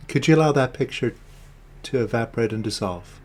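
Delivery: measured meditation guidance with long silences and falling endings; guided meditation. Real, not acted. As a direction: lead them inward, one short instruction at a time.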